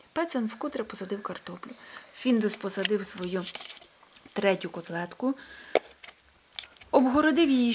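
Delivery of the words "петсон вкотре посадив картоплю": ordinary delivery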